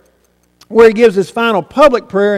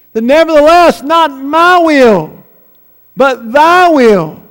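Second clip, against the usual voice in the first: raised, drawn-out preacher's proclamation; emphatic surrender; 3 semitones higher, 4 dB louder than usual. Acted. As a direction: ring it out slowly and forcefully. Climactic repeat of Christ's surrender.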